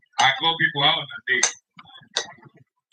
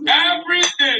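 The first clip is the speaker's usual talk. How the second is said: high and loud hype; hyped up; 11 semitones higher, 5 dB louder than usual. Real, not acted. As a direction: pump the crowd up.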